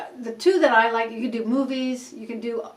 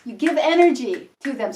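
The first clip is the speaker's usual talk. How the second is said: ordinary delivery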